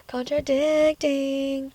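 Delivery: high, very slow drawn-out "Contradicting"; sing-song tease at herself; mock self-correction. Real, not acted. Vlogger teasing herself for contradicting, drawn out high.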